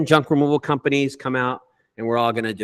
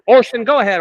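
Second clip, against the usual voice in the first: louder, higher call to a friend; 8 semitones higher, 7 dB louder than usual. Real, not acted. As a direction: hand over the floor loudly. Calls on Orson to speak.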